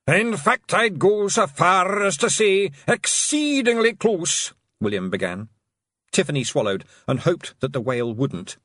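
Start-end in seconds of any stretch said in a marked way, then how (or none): 0.1-4.5 high, slow, loud pixie brogue; drawn-out mock-formal Scots. William to Rob, high slow Scots pixie voice.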